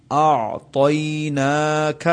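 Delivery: أَعْطَيْنَاكَ with the ع and ط sounded apart; slow recitation; clear articulation. Shown as a demonstration. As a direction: say أَعْطَيْنَاكَ letter by letter. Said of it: "ہر مخرج الگ الگ ادا ہو".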